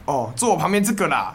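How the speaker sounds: playful Taiwanese TV host accent. Shown as a demonstration. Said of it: "台湾腔".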